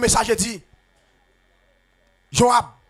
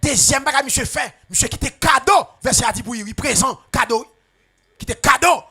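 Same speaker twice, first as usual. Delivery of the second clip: higher, louder, half-chanted preaching; fervent preaching; 5 semitones higher, 7 dB louder than usual. Acted. Preacher warning the flock about a trapped gift, in a loud half-sung cadence. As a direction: build it up like a revival preacher.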